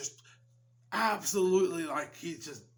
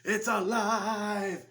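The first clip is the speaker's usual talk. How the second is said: slow, loud and higher triumphant cry; hammed-up crazed triumph; mock mad-scientist cry; 6 semitones higher, 8 dB louder, much slower than usual. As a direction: belt "It's alive" slowly like a crazed doctor. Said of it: "the infamous scene where he's like".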